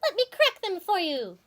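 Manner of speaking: high, squeaky coaxing chipmunk. Acted. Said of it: "said the chipmunk".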